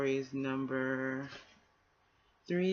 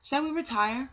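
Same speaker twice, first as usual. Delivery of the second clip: higher and louder, a lightly voiced boar; high boar reply; 9 semitones higher, 4 dB louder than usual. First boar agreeing with the other, higher and louder. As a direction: give the boar a lifted, agreeable voice.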